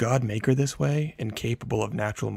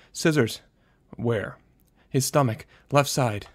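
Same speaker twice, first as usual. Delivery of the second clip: panicked; 4 semitones higher, 5 dB louder than usual. A narrator's reading. Frantic answers to dispatcher.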